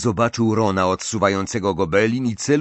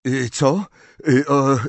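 halting, higher stammer; flustered; 5 semitones higher, 4 dB louder than usual. A narrator's reading. Harry avoids answering Ron's question, flustered. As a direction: stall with broken filler sounds.

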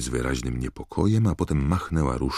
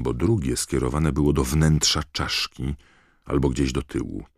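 ordinary delivery, nothing marked

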